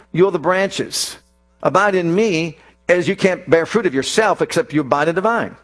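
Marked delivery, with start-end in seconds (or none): none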